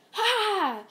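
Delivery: high, loud battle cry Ha; power-up shout. Retold or imitated. Deku powers up to charge Frieza, shouting Ha. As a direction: blast it out as he powers up.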